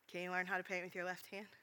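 quiet, gentle question. Retold or imitated. Imitating friend turning the answer back on him, quiet. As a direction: ask it softly after the shouting. Friend's calm reply after shouting.

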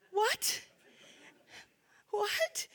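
high, rising incredulous question; stunned disbelief. Retold or imitated. Reacting to friend's remark about her face, stunned disbelief. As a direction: squeak it out, as if you can't believe it.

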